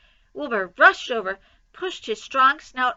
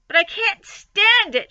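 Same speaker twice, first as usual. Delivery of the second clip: high, loud shout; 10 semitones higher, 8 dB louder than usual. Acted. Wilbur shouting at Charlotte, high and loud. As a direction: shout your protest. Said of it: "shouted Wilbur".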